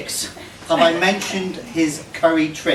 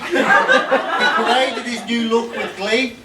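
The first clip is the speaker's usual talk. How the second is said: laughing; 6 semitones higher, slower than usual. Real, not acted. Reading while laughing.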